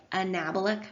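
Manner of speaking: "anabolic" stressed on the second syllable, a-NAB-olic; corrected pronunciation. Real, not acted. Lecturer apologizing to students, correcting anabolic to a-NAB-olic. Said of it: "it's anabolic".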